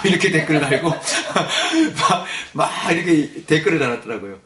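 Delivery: chuckling through the words; laughing. Real, not acted. Pastor laughing to the congregation over a Facebook comment. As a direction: laugh while telling the comment.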